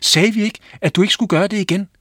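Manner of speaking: high, loud and fast scolding; angry. Acted. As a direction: tell the boy off in a fury. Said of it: "Mændene blev rasende".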